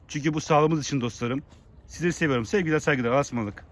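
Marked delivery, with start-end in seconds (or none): none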